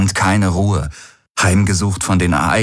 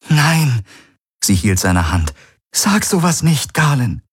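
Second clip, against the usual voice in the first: begging Key; 7 semitones higher than usual. Acted. Key begs him.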